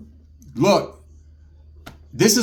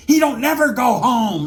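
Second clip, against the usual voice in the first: scoffing disbelief; 8 semitones higher than usual. Retold or imitated. Lieutenant scoffing that he never goes home, high and slow. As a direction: scoff at the very idea.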